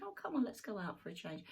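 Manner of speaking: ordinary talk, nothing marked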